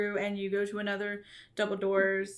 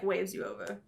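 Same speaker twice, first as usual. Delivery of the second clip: normal talking voice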